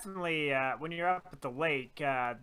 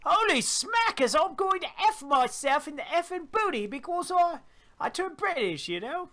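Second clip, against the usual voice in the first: high-pitched hammed-up Australian slipping into British; Australian accent; 14 semitones higher, 4 dB louder than usual. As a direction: mimic an excitable Australian woman, badly drifting British. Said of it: "I shouldn't do the Australian".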